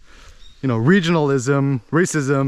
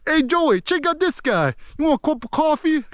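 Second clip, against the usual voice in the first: much higher, put-on Boston-area accent; 12 semitones higher than usual. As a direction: imitate an East Coast Boston guy, pitched way up. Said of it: "Kinda, like, talking like that".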